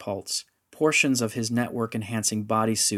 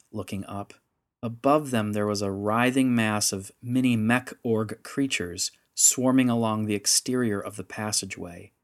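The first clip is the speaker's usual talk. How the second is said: plain reading voice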